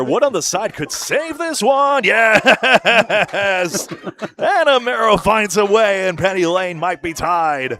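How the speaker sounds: loud, high sportscaster call; excited bowling commentary at full voice; excited play-by-play. Real, not acted. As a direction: burst out with the big call, then ride it down.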